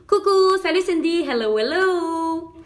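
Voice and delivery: high, loud sing-out hello; excited greeting. Real, not acted. Host saying hello to Cindy on a live, excited. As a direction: call out loudly, stretching the last hello.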